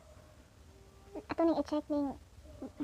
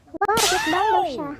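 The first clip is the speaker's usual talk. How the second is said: loud, high, drawn-out wow; excited exclamation; 5 semitones higher, 10 dB louder, slower than usual. Real, not acted. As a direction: exclaim it loudly and slowly, pitch up. Excited reaction to game. (kusog ug taas nga pagkahingangha)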